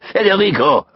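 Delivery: put-on high, scornful French-accented exclamation; spat-out scorn. A narrator's reading. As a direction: spit out the name in disgust. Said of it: "Didier spat out".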